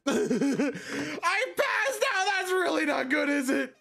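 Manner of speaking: high, drawn-out laughing wail; laughing outcry. Real, not acted. Streamer finding the character passed out, laughing outcry. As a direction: burst into laughter, then squeal the words.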